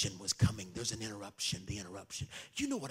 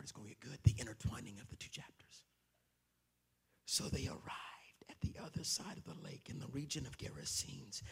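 hushed suspense whisper; storyteller whispering before the big reveal; 4 semitones lower, 11 dB quieter than usual. Acted. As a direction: whisper it like a secret. Building suspense before scripture.

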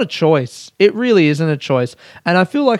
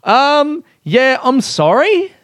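sarcastic apology; 10 semitones higher, slower than usual. Pretend. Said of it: "Sarcastic apology".

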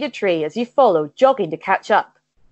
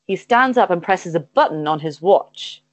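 usual speaking voice

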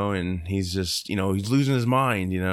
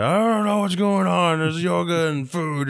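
frantic Nicolas Cage; 9 semitones higher than usual. Acted. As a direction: wail it like a man losing his mind. Acting out Cage losing it.

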